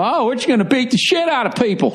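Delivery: high mocking voice of a brawling team; mock hockey goon. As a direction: ham up the dumb tough guy. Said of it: "You know".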